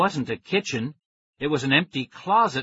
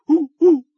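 loud, very high screeching hoots; frantic chimp hoots; 12 dB louder than usual. A narrator's reading. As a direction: hoot wildly like an agitated chimp. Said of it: "hopping furiously up and down".

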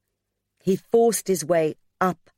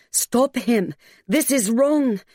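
higher, urgent inner alarm; hammering inner voice; 7 semitones higher, slower than usual. A narrator's reading. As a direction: hammer out each warning in alarm. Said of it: "a voice hammered".

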